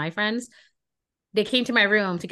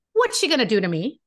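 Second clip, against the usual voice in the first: much higher, incredulous, building outrage; indignant rant; 9 semitones higher than usual. Retold or imitated. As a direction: scoff at the threat in disbelief. Retold defiant rant at roommate.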